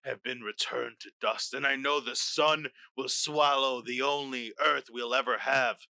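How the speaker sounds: put-on slow, weighty Russian-accented speech; grave Russian accent, fading to a low confession; Russian accent with gravitas. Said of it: "But I got the gravitas".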